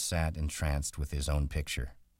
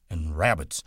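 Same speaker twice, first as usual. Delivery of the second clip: louder, eager interjection; 6 dB louder than usual. Acted. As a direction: jump in about the rabbits. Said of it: "Lenny said eagerly".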